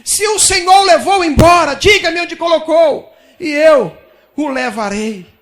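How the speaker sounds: loud, slowing pleading shout; anguished plea. Acted. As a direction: beg the gardener at full voice, drawing it out. Maria voiced pleading with gardener. (Maria suplicando ao jardineiro, em voz alta e lenta)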